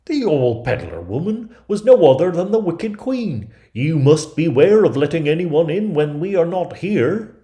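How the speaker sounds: urgent dwarfs raising the alarm; dwarfs warning. Acted. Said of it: "they said".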